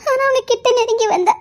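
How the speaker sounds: normal talking voice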